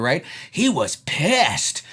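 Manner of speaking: usual speaking voice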